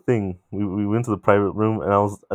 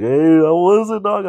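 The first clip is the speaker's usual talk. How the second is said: higher, louder, slow teasing ask; cousins teasing; 9 semitones higher, 5 dB louder, slower than usual. Retold or imitated. Cousins asking Pedro how it went, loud and teasing. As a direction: rib him like a cousin. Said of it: "all my cousins like".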